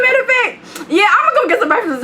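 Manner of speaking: high, mock-casual fake excitement. Narrator faking casual to her friend, high and sing-song. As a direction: pretend you suddenly want breakfast. Retelling the same fake line.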